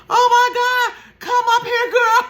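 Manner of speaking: high, loud alarmed call; panicked call. Retold or imitated. Storyteller calling to her drowning sister in high loud alarm.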